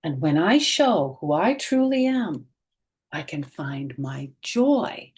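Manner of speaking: bright, expressive picture-book read-aloud; reading to children. A narrator's reading. Teacher reading a picture book aloud to children, expressive. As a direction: read it slowly and warmly to young listeners.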